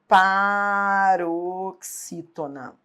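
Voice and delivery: paroxítona stretched very slowly, syllable by syllable; drawn-out syllables. Shown as a demonstration. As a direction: draw out each syllable of paroxítona for the class. Teacher spells out the term slowly. (paroxítona bem devagar, sílaba por sílaba)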